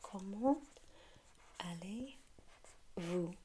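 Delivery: "allez-vous" stretched out very slowly after "Comment"; slowed-down "Comment allez-vous" for the learner; slow drill. Shown as a demonstration. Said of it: "yes, very good".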